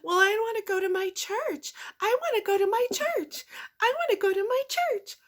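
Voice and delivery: very high, repetitive sing-song parody; mock quiet whine. Man refusing the lake for church, mockingly imitated in high voice. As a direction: mimic him insisting in a tiny high voice. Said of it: "he would just quietly tell you".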